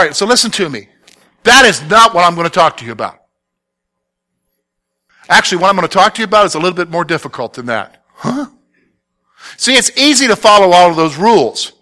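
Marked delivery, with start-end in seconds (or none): none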